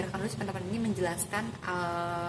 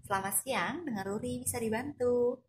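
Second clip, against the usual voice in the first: smiling, higher-pitched receptionist greeting; smiling phone greeting; 4 semitones higher than usual. Shown as a demonstration. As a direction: friendly smile in the voice, lifting as it goes. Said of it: "aku sambil tersenyum".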